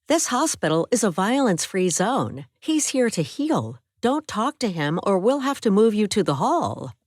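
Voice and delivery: lightly voiced nurse laying down the rules; mild stern nurse warning. Nurse warns roommate.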